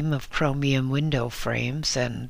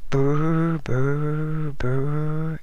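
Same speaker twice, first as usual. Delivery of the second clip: imitated phone burr; 3 semitones higher, much slower than usual. A narrator's reading. A garage phone ringing on and on, mock burring. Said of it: "The telephone burred insistently".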